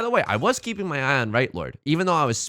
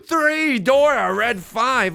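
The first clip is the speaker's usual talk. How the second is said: excited announcement; 10 semitones higher, 6 dB louder, much slower than usual. Real, not acted. Announcing a winning hand.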